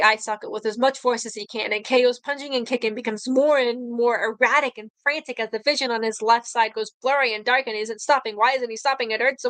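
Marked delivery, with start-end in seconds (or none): none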